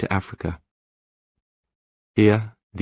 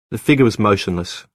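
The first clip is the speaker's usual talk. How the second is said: usual speaking voice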